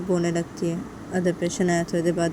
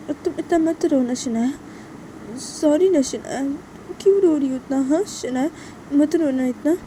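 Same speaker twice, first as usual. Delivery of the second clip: higher, halting apology; pleading Tisha; 9 semitones higher, 4 dB louder, slower than usual. Acted. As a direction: plead with a crying friend to forgive you. Voices Tisha soothing crying Shanaya. (रोती दोस्त को मनाती, मिन्नत भरी आवाज़)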